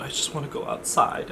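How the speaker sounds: hushed, breathy plea; whispered wish. Retold or imitated. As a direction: whisper it so the family doesn't hear. Mimics himself at family dinner.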